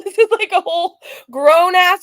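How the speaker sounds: cracking up mid-sentence; laughing. Real, not acted. Reactor mocking the grown-up creator, laughing.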